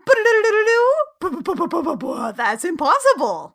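high, loud babbling nonsense then denial; stammering gibberish from a shocked girlfriend; flustered sputtering. Retold or imitated. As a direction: sputter in shock before finding words.

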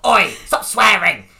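loud, slow parental shout; parent telling off. Retold or imitated. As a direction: bark it like a cross dad. Parent voiced telling off at dinner.

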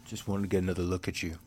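soft, low, quick murmur; mock movie-star line. Host imagines the film star's reply, low impression. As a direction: play the star, low and hushed. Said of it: "And he'll be like".

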